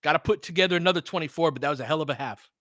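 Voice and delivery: ordinary talk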